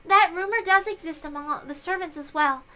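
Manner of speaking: usual speaking voice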